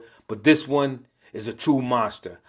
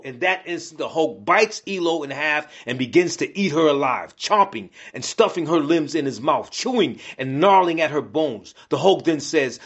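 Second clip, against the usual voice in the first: ordinary delivery, nothing marked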